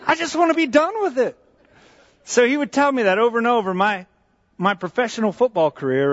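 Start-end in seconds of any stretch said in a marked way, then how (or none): 0.0-1.4 put-on higher, laughing kid's protest; a boy who can't believe the advice; incredulous kid. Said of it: "And I said".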